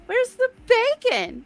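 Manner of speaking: put-on high, slow, bewildered child's complaint; upset kid. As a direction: imitate a little kid demanding an answer. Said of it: "remember being a kid going".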